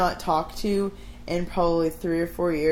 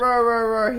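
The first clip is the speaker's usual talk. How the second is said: higher, louder "rah rah" bark; imitated rant noise; 4 semitones higher, 7 dB louder than usual. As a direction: demonstrate someone's ranting bark. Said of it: "Well, not quite".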